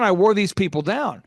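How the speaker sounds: ordinary talk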